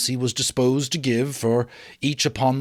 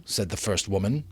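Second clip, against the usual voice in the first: usual speaking voice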